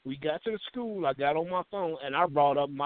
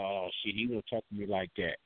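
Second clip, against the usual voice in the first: mocking commenters; 9 semitones lower, 7 dB quieter than usual. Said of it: "talking about".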